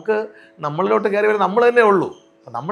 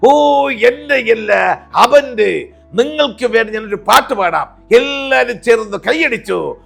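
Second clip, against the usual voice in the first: loud, slow, pitched-up villain-comedian drawl; Janardhanan impression; 4 semitones higher, 7 dB louder, slower than usual. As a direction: drawl it out like the old villain actor turned comic. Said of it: "Shri Janardhanan".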